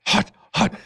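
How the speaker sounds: high-pitched imitation of a scalded kid; mock burned-mouth yelp. Dad imitating his daughter after the wasabi, high mock yelp. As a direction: lightly act out a high little yelp.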